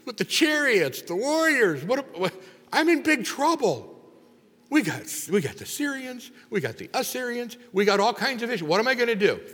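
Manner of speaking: high, loud, desperate pleading as the frightened king; panicked king. Acted. King panics over enemies and Elisha.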